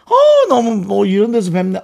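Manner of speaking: high, loud, slow mock greeting; imitated delighted surprise. Hiker imitates other people greeting him, in delighted surprise. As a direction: act out a pleasantly surprised fan.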